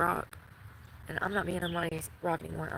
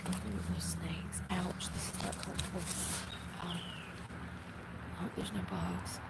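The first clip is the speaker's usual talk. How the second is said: whispering; 7 dB quieter than usual. Real, not acted. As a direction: whisper it softly, uncomfortable. Whispering while lying down uncomfortably.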